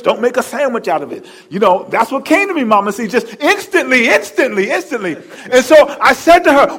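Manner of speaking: ordinary talk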